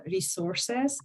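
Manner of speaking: ordinary talk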